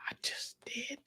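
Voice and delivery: barely audible whisper; whispered admission. Real, not acted. Owning up to the co-host's challenge, whispered. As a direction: whisper it, almost to yourself. Hushed repeat of own claim.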